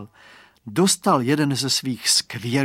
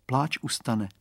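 ordinary delivery

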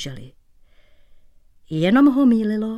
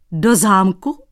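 yelp of disbelief; 6 dB louder, slower than usual. Acted. Shoemaker yelping at the painter's plan, loud surprise. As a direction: shriek it in disbelief. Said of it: "Vyjekl Dratvička".

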